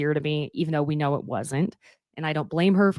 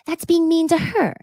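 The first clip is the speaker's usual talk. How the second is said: Dorit's indignation; 12 semitones higher, 3 dB louder than usual. Retold or imitated. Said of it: "Dorit looks at Erica and is like".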